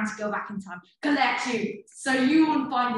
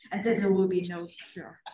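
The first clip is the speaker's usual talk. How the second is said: ordinary talk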